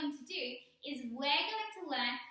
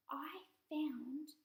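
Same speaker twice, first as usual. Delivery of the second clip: soft secret whisper; 7 dB quieter than usual. Acted. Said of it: "unvoiced whisper".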